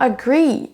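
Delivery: "agree" with long GREE; weak a, strong GREE in "agree"; second-syllable stress. Shown as a demonstration. Teacher introduces the model, "agree" stressed on GREE. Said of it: "c'est sur la deuxième syllabe".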